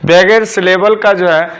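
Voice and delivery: ordinary talk, nothing marked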